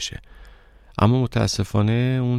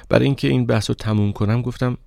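ordinary delivery

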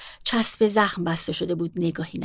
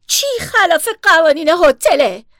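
higher, louder, slow outraged question; outraged shout; 8 semitones higher, 9 dB louder than usual. Acted. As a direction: hurl the question back at her. Barry outraged at the rule.

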